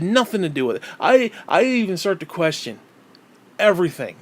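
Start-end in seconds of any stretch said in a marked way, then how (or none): none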